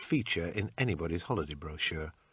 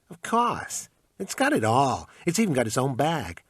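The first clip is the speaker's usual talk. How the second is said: pawn shop salesman; 5 semitones higher than usual. Acted. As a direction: sell it like a pushy clerk. Shopkeeper haggling.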